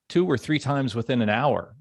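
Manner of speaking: ordinary talk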